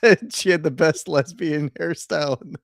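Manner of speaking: talking through real laughter; laughing. Real, not acted. Co-host apologising for laughing at a hairstyle joke, laughing. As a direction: apologise while cracking up, words breaking into laughs. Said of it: "I'm laughing about Lutz".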